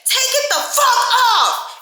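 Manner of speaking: furious, high-pitched full-volume scream; screamed order. Retold or imitated. Charlotte orders the child to remove the skirt, screaming furiously. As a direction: scream it slowly, hitting every word hard. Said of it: "scream in my face like that".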